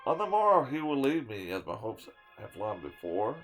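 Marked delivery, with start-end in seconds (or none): none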